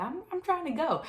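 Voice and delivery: high, soft plea; retold anxious plea; pleading. Worried recruit pleading to leave.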